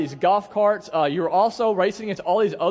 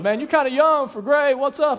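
higher, louder mock cashier voice; teasing cashier; 7 semitones higher, 5 dB louder than usual. Cashier teasing the preacher about gray hair, high and loud. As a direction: rib him like a cheeky cashier.

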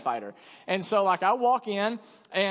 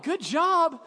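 higher, disbelieving echo; incredulous; 9 semitones higher, 3 dB louder than usual. Retold or imitated. Pastor echoing the praise after a beating, incredulous. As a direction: repeat it like you can't believe it.

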